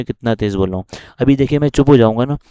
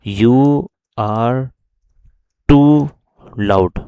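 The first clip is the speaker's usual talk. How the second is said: drawn out word by word, too louder; dictated slowly; 5 dB louder, much slower than usual. Shown as a demonstration. Teacher typing the warning text, dictating slowly. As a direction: dictate it slowly with a pause after each word. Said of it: "यहाँ पे लिख देना है कि भाई".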